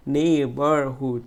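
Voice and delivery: "Neighbourhood" slowed, first syllable stretched; stress on নেই. Shown as a demonstration. Teacher repeating the word for students, stress on long নেই. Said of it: "stretch word".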